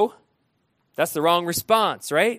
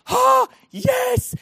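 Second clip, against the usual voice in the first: high excited shout of joy; a sudden joyful yell; excited cry; 5 dB louder than usual. Retold or imitated. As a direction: cry out as if spotting water. Excited find of water.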